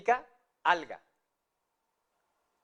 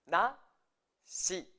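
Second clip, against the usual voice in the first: NASHI split into na, shi with a pause between; syllable by syllable. Shown as a demonstration. Teacher showing learners a Japanese word, syllable by syllable.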